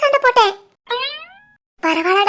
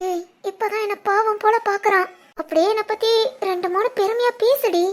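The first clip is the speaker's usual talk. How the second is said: soft, conspiratorial undertone; hushed aside; 6 dB quieter than usual. Acted. Friends scheming while Ram watches them, hushed aside. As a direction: keep it down so he can't overhear.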